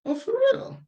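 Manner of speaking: very high, surprised voice; high mock surprise on hearing about his organization. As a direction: act amazed. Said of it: "I was like".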